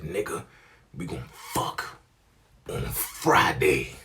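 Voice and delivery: low, slow imitation of her; slow, breathy mock seduction; brazen come-on.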